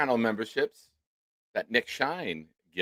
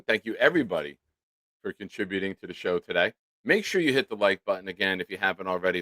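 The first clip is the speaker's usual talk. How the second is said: ordinary delivery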